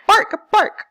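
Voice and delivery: high, loud dog-like barks; imitated barking. Shown as a demonstration. Teacher showing pupils a word that produces sound, high loud barking. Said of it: "they produce sound".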